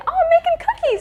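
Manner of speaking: very high, airy mock-casual reply; mock casual sister. Sister lying to her sibling about baking, mock casual. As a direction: toss it off high and innocent. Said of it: "she'll just casually say".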